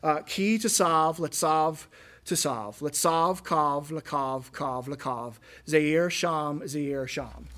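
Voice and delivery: slow, chanted Hebrew syllables, sav and kav drawn out; Hebrew recitation. Shown as a demonstration. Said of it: "here's what it sounds like in Hebrew".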